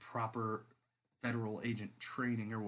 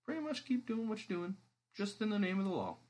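voiced agent; 9 semitones higher than usual. Jake's recruiting offer voiced.

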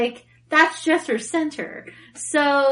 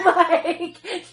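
ordinary talk

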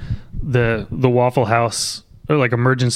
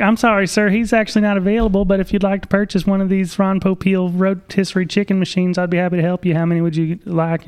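high, brisk sales-rep voice; mock salesman; 7 semitones higher than usual. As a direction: chirp it like an overly polite operator. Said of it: "And he'd be, be like".

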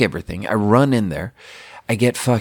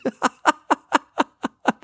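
high laugh. Real, not acted. Laughs at own retold freakout.

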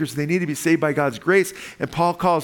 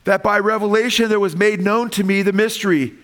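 higher and louder, proclaiming the verse; fervent preaching; 7 semitones higher, 4 dB louder than usual. Acted. Pastor rereading the verse to the congregation, proclaiming. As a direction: declare "That by revelation" like a revelation itself.

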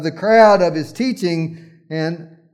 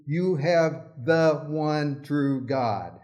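ordinary delivery

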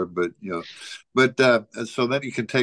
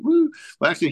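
usual speaking voice

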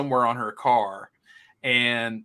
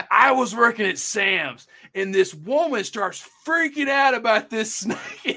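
higher-pitched retold storyteller, amused; guy telling his story; 10 semitones higher, 3 dB louder than usual. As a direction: imitate an animated guy recounting it, high-voiced. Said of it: "he's telling this story to me".

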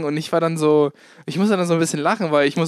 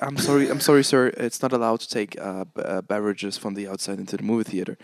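polite English staff voice; 7 semitones lower, 3 dB quieter than usual. Retold or imitated. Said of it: "Dann sag ich".

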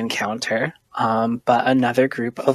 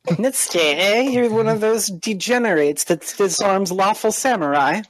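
higher-pitched contemptuous sneer; scornful samurai; 7 semitones higher than usual. Acted. Warrior insults an official who disarms samurai, scornful. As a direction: sneer at the title in disbelief. Game master voices hostile samurai.

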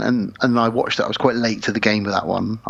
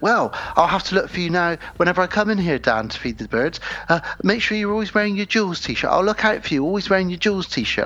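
higher-pitched mimicry of an eager new acquaintance; imitating overfriendly stranger; 7 semitones higher than usual. Stranger claiming a new best friend, imitated high and eager. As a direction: put on her keen, high-voiced chatter. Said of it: "And then she went".

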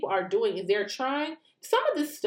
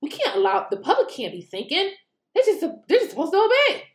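mocking frustrated official; 6 semitones higher, 4 dB louder than usual. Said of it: "It's like".

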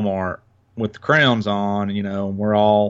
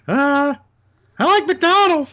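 put-on high little kid voice; squeaky voice of a small kid; 4 dB louder, slower than usual. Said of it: "the little kid's like".

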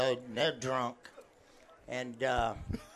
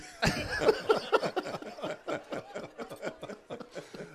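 long laugh after the punchline; laughter; 10 semitones higher than usual. Real, not acted. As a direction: crack up at own joke.

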